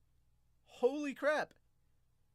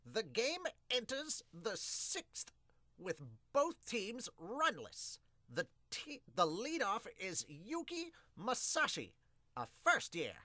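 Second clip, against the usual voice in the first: slow, higher sportscaster read of the game; play-by-play; 8 semitones higher, slower than usual. Acted. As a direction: call the inning like a TV announcer. Reading play-by-play of manga game.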